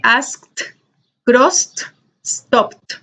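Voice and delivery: ASKED, CROSSED, STOPPED each with a released T; final T sound. Shown as a demonstration. Teacher reviews the second ending, final T sound on asked, crossed, stopped. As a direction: pop a sharp T at the end of asked, crossed, stopped. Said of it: "La T es, como su nombre lo dice".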